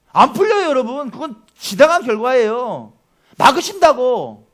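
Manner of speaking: loud, high emphatic preaching; fervent preacher exclaiming to the congregation; preaching at full force. Real, not acted. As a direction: drive the point home with force.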